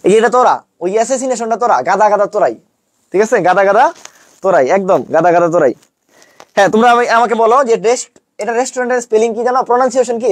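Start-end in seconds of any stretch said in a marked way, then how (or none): none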